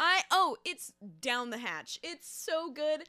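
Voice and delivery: gleeful delight. Pretend. Gleeful mock chugging.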